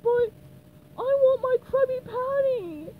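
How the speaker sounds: high, loud wail for food; crying out. Acted. Patrick cries out for his Krabby Patty, loud wail. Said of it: "cried Patrick".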